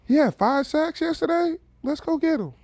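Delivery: high drawling Southern voice; southern accent. Retold or imitated. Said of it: "I like your southern accent".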